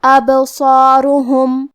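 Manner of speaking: ابصارہم with the ب bounced, a slight echo, slow; slow demo with ب bounced in ابصارہم; qalqala on ba. Shown as a demonstration. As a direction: read ابصارہم slowly, let the ب jolt. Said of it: "تو ہم با کو ہلاتے ہوئے یہ کلمہ کو پڑھیں گے".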